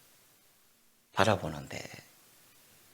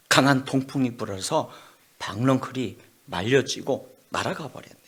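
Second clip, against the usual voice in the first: usual speaking voice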